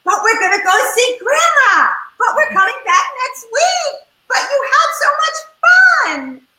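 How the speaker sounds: put-on high, loud childish pleading; whiny kids protesting. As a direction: whine like a kid being dragged away. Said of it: "So many of them are saying".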